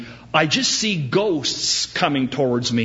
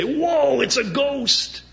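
high startled yell of fright; mock terrified shriek; frightened cry; 13 semitones higher, slower than usual. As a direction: cry out as if seeing a ghost. Disciples see figure on water.

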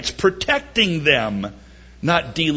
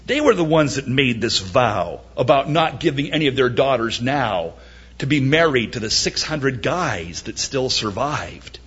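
usual speaking voice

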